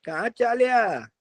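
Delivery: high, slow crow voice; curious crow. Acted. Crow asks the deer who the stranger is, curious. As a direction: call out like a nosy crow.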